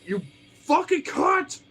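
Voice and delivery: slow, very high, loud yell; re-enacted furious shriek; mad outburst. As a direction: stretch the insult into a high scream. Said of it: "'cause I was mad".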